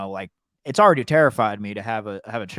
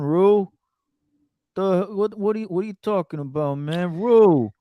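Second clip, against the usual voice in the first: higher, louder mumbled nagging with lips barely moving; Angus Cloud impression; 6 semitones higher, 5 dB louder than usual. Fezco scolding Rue about what she's doing, in a higher, louder impression. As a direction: keep calling Rue's name like an exasperated friend. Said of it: "here's my Angus Cloud impression".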